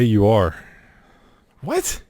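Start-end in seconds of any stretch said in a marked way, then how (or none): 1.6-2.0 baffled what. Real, not acted. Baffled by the quiz question.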